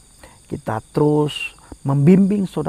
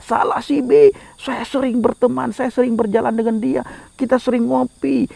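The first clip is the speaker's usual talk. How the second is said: high, insistent cry of blame; accusing spirit crying out; 10 semitones higher than usual. Acted. Dead friend's spirit blames those who never preached, crying out. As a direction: voice a lost soul's mock outcry, pitched up. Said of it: "Roh dia akan berteriak".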